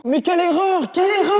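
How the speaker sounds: dramatic lament. Acted. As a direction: wail it like a tragedy. Lamenting his own choice.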